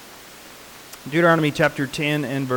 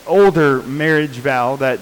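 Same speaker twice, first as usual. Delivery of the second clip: ordinary talk, nothing marked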